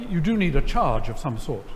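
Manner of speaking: ordinary delivery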